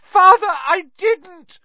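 desperate high, loud shriek. A narrator's reading. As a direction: shriek a slow, pleading denial to your father. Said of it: "shrieked the boy in chains below".

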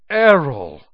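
loud, exasperated call of the owl's name; exasperated cry. Acted. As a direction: blurt it out, fed up with the clumsy owl. Owl crashes into milk jug.

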